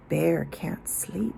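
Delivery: soft whispered line. A narrator's reading. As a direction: hush it like everyone's asleep. Quiet dawn in children's story.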